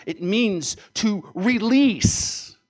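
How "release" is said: "release" stretched long and loud; forceful. Shown as a demonstration. Preacher defining the Greek word, forceful on "release". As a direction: push "release" out hard and slow.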